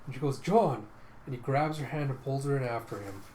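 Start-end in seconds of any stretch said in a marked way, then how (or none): 0.4-0.9 sudden higher, louder call. Retold or imitated. Vilma spots John in an alcove, calling out. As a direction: call his name out in surprise.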